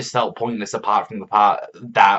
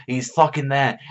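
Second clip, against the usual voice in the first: normal talking voice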